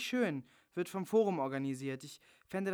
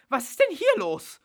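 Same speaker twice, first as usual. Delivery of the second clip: higher, louder alarmed question; 10 semitones higher, 11 dB louder than usual. Acted. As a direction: storm in demanding answers. Bella bursting in.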